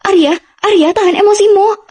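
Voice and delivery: high, loud urgent cry to hold him back; urgent pleading shout. Acted. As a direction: call out to stop him. Mother restrains angry son. (teriakan panik menahan anak yang marah)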